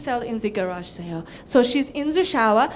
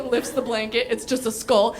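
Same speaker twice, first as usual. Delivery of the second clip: ordinary talk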